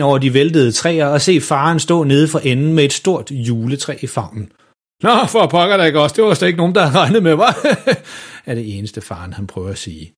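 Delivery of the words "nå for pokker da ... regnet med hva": højere, nervøst grinende; pinlig latter. A narrator's reading. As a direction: grin det væk. Far er grebet og griner pinligt. (far der ler pinligt efter at være afsløret)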